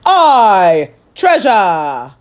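loud crew cheer; pirates shouting. Acted. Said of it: "the others shouted".

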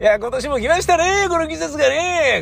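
high, bright mock chatter; cheery festival neighbor. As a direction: welcome the season like a festival regular. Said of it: "なんかって".

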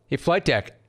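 ordinary delivery

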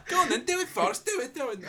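high, insistent Scouse pestering; mock Scouse goading. Little Scouser bullying the actor to do it, high Scouse. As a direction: goad him in a high Scouse voice.

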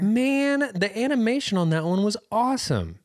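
high, thrilled praise; excited. Real, not acted. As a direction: gush about it. Excited about animation.

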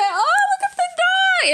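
high, delighted squeal of a mom spotting a dog; excited mom. Retold or imitated. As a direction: imitate mom cooing over a cute dog, way up high. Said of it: "And my mom said".

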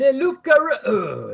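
high, slow startled cry; shocked French cry. Acted. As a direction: gasp it out in alarm, French. Cop sees werewolf, mock French.

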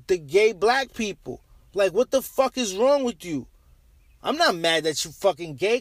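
ordinary talk, nothing marked

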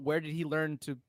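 usual speaking voice

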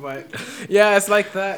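normal talking voice

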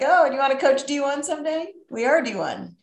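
playful, hammed-up re-quote; teasing repeat. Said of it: "we kept repeating that".

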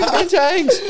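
high-pitched imitated rider's holler; mock yelling. Rider hollering on a Bali road, imitated high yell. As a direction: holler it high, half laughing. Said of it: "he was just yelling".

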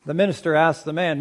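ordinary talk

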